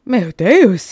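a stunned Portuguese exclamation; gasped. Acted. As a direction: breathe it out in amazement. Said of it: "he gasped".

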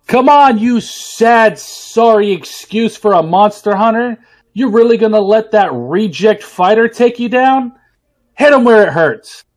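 loud, high barking drill sergeant. Acted. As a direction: yell it like a drill instructor. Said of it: "Sensei's gonna go ahead and yell out".

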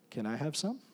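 soft, mock little child voice; small child asking. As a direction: lightly voice a small boy asking for food. Little son asking for food.

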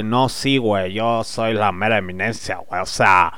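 smug provincial bragging, lightly voiced; cocky show-off. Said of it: "se la quieren creer así de".

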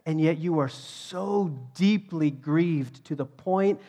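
God confronting the prophet, voice building; forceful, mounting preacher's rebuke; impassioned reproach. Retold or imitated. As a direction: press each point harder, rising in pitch and volume. God confronting Jonah in retold dialogue.

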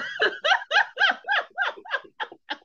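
a long high laugh that falls away; high-pitched laughter. Real, not acted. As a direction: laugh helplessly at your own joke. Laughing at own Amanda bit.

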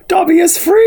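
high squeaky house-elf voice; Dobby voice. Retold or imitated. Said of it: "he has the famous quote of".

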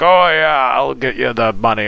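lightly voiced casual drunk; loose, offhand imitation of a drunk; drunk person. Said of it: "a drunk person's like".

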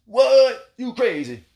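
high, loud startled What; mock shock. Friend reacting to the visitor's story with high, loud shock. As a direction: jolt up in disbelief.

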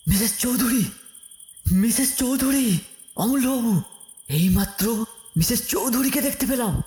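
high, choked gasping panic; breathless. Acted. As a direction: blurt it out with no breath left. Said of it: "আমি প্রায় রুদ্ধস্বরে বলে উঠলুম".

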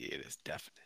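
soft whisper. Acted. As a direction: whisper it firmly. Joking insistence.

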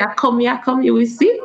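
grandma's reply in light Jamaican patois; a touch of Jamaican patois, fond retelling; gently imitated Jamaican patois proverb. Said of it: "speaking Jamaican dialect just then".

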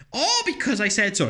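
high, dismissive parent voice on "Oh, because I said so."; mock parent brush-off. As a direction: mimic a lazy parent shutting a child down. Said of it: "make up some bullshit of".

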